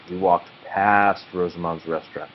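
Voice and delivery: ordinary delivery, nothing marked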